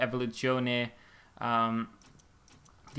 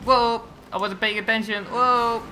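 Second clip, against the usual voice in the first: startled; 7 semitones higher, 4 dB louder than usual. Real, not acted. Startled while playing.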